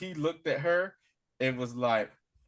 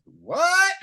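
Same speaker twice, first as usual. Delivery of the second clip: high, loud mock-shocked outburst; astonished cry; 10 dB louder than usual. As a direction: exclaim it in comic disbelief. Retold startled reaction to rescue.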